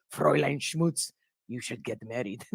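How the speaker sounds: low, hammed-up German accent; bad German accent. Germans telling her to marry, in a poor German accent. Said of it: "obviously a German accent, I'm doing horrible".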